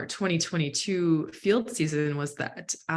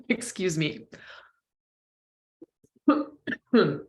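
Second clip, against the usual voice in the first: hoarse, coughing, voice failing; strained voice breaking up with a cold; voice giving out; slower than usual. Real, not acted. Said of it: "My voice is giving out".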